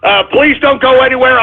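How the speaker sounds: normal talking voice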